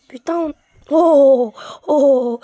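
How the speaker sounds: loud startled cry of alarm; startled shout. Real, not acted. Player startled by a mob, shouting in alarm. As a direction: yelp as if you nearly died. Nearly died in the game.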